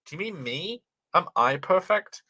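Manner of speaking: usual speaking voice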